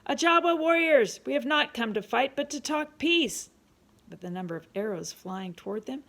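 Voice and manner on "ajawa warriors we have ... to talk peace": high, loud proclamation to warriors afar; calling out loudly. A narrator's reading. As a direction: project a plea for peace across a field. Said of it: "called out in a loud voice".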